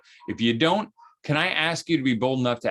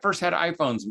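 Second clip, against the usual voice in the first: ordinary talk, nothing marked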